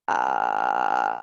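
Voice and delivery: long, very low creaky "uh"; creepy sustained fry; vocal fry. Shown as a demonstration. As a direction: hold a creaky croak at the bottom of the voice. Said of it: "So the vocal fry is".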